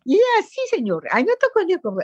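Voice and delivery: high-pitched imitation Spanish accent. Woman recalling how she embarrassed her husband in Mexico, imitated Spanish accent. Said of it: "you're speaking with a Spanish accent".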